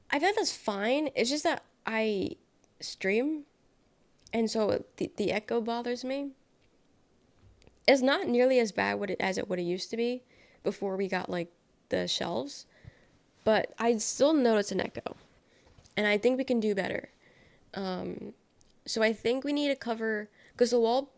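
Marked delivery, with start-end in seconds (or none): none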